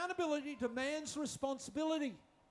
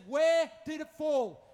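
much louder, slow searching call; loud slow question; 3 semitones higher, 9 dB louder, slower than usual. Retold or imitated. Prophet asking the man where it fell, loud and slow. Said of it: "the prophet, he said".

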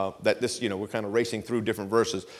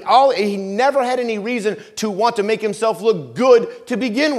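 high, loud, driving preacher voice; fervent preaching; 8 semitones higher, 6 dB louder than usual. Acted. Pastor stirring the congregation, loud fervent preaching. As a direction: preach it full force, building.

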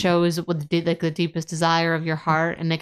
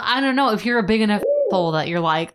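ordinary delivery

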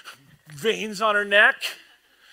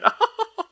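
mock girlish giggle, much higher; a swooning little giggle.

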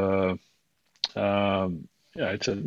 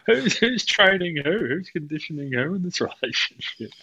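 laughing question; 10 semitones higher than usual. Retold or imitated. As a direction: tease the client with laughter breaking in. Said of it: "And I said".

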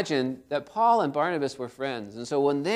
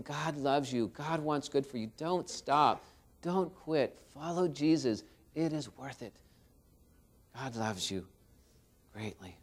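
softer, tender reassurance; gentle encouragement; 7 dB quieter than usual. Retold or imitated. Barnabas and Paul reassure the Gentiles, soft tender voice. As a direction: say it gently like to a frightened child.

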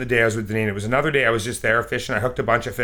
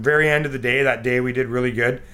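ordinary talk

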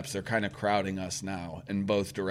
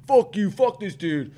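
higher put-on bully voice; drawn-out, higher mocking taunt; mock hostile jeering; 8 semitones higher, 3 dB louder, slower than usual. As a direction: imitate the cool guys ganging up. Acting out hostile cool guys.

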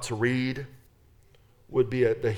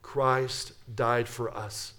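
prayer. Acted. Leads closing prayer.

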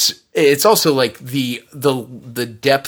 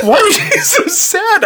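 put-on much higher, laughing through a child's question; laughing kid quote. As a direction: giggle while quoting the little kid. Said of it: "He's like".

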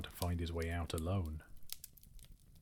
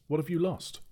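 high, raised questioning call; pitched up to carry; 9 semitones higher, 4 dB louder than usual. A narrator's reading. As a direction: call the question across the dark. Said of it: "Bilbo called".

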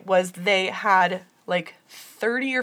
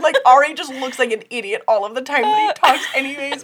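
high, loud words through real laughter; helpless, shouted hilarity; laughing hard; 12 semitones higher, 10 dB louder than usual. Real, not acted. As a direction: crack up while mocking someone. Cracking up at the imagined scene.

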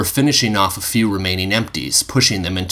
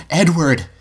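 high urgent shout; 9 semitones higher, 3 dB louder than usual. A narrator's reading. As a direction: try to shake him awake with the name. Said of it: "he shouted".

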